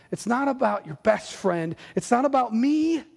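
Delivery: usual speaking voice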